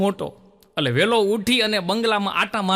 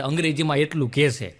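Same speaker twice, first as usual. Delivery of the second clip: usual speaking voice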